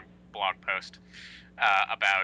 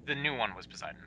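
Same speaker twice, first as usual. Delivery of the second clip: breathy whisper, quick aside; 5 dB quieter, much faster than usual. Real, not acted. Host whispers a correction about the Poseidon title. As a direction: drop to a whisper for the side note.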